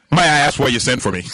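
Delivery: low, sudden anticlimax; deflated question after conviction. Acted. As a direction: drop from lofty conviction into a plain, puzzled question. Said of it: "he's got all this conviction".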